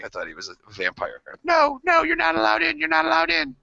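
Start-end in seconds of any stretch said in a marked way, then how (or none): 1.4-3.6 put-on very high, frightened kid's protest; mock panicked arguing. Scared kid arguing with vampire brother, mock panic. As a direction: refuse entry in a squealing panic. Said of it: "I sat there and argued with him".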